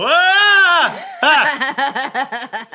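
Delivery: high, loud startled shriek; little scream at the end. Shown as a demonstration. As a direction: yelp when someone jumps out. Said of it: "a wee "Ah!".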